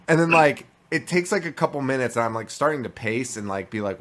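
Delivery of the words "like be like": normal talking voice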